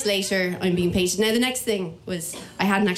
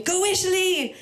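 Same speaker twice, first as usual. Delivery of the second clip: retold crowd cheer; 8 semitones higher than usual. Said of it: "people screaming".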